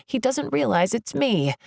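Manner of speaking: usual speaking voice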